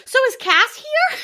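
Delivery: high, loud eager ask; eager question. Retold or imitated. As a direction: perk right up, hopeful. Eager question, comic timing.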